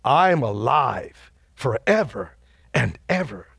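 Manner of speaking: slow, weighty divine pronouncement; Jesus speaking solemnly. Acted. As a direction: drop into a measured voice with long pauses. Voices Jesus's words in the vision.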